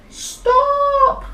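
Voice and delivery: very high, loud, drawn-out yell; desperate cry. Acted. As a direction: scream to halt the monster. Swallowed boy cries out.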